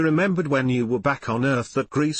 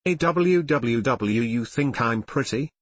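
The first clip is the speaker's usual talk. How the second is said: ordinary delivery, nothing marked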